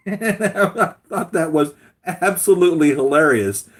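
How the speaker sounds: laughing through it, slow. Real, not acted. Vlogger recalling the funny scene, laughing. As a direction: still cracking up.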